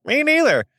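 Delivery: high, light comic reply; playful squeak. Retold or imitated. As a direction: toss it back like a joke. Joking agreement, retold.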